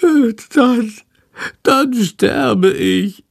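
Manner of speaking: high, drawn-out bat lament; squeaky, melodramatic misery; wailing. Acted. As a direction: whine it slowly, as if expiring. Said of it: "jammert Shandor".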